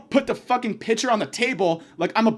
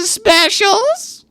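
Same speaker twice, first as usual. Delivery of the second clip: high, loud mock of the waitress; loud squeaky impression of the waitress; 12 semitones higher, 7 dB louder than usual. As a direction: squeal it like she did. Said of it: "and I hear".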